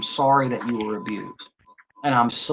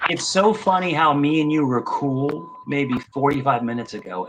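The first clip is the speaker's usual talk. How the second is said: ordinary talk, nothing marked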